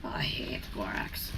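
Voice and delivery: whispered aside, unvoiced. Real, not acted. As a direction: breathe it out as a secret grumble. Aside about hated borax.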